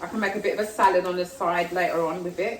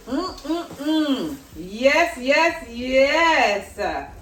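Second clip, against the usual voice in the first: high, louder yes yes yes of pleasure; delighted tasting; 6 semitones higher, 5 dB louder, much slower than usual. Real, not acted. Tasting the cheesy sauce, high delighted yeses. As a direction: swoon over the flavour.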